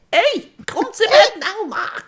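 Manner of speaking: high, drawn-out Scouse screech; hammed-up whiny Scouse nag; screaming Scouse. Co-host henpecking Mark to come to bed, screaming Scouse accent. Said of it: "you two screaming at me".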